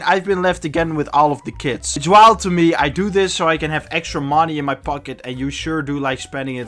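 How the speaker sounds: ordinary talk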